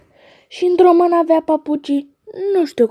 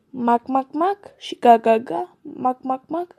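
quacking; 4 dB quieter than usual. Acted. Ducks quacking in poem.

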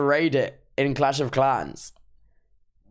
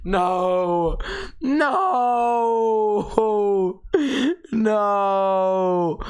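mock wail; 5 semitones higher, much slower than usual. Mock anguish at chat.

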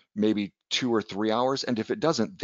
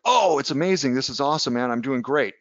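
higher, louder burst on the first words, easing off; mock enthusiasm; 4 semitones higher, 3 dB louder than usual. Losing trader boasts to his caller, mock enthusiastic. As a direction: play an overjoyed trader, pitched up.

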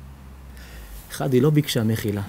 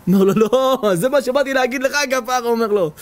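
excited pleading; 10 semitones higher than usual. Retold or imitated. Pressing the treasure on him.

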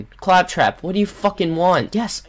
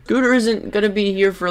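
usual speaking voice

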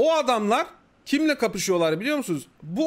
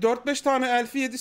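normal talking voice

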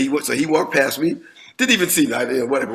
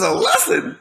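incredulous. Retold or imitated. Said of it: "And he says".

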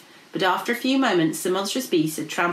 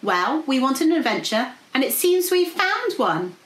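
high, eager princess voice; rally your friends for adventure; plucky princess; 7 semitones higher, 3 dB louder than usual. Acted. Princess rallies her friends.

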